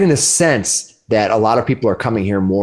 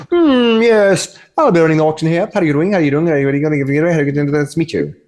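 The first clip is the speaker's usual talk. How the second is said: high-pitched auctioneer voice; 6 semitones higher, faster than usual. Acted. GM voicing the auctioneer NPC.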